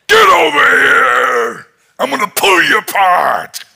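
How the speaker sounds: high, loud furious bellow; Goliath shouting. Retold or imitated. Giant Goliath bellows at David, furious shout. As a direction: roar it like an enraged giant. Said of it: "he's going".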